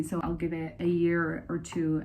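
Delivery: normal talking voice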